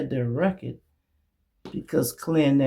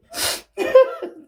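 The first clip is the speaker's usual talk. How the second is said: real laugh, much higher and louder; 5 dB louder than usual. Real, not acted. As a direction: burst out laughing. Laughs at own impression.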